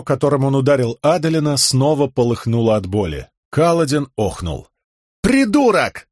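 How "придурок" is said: sharp high yell. Acted. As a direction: shout it in anger. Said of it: "заорал Зайхель".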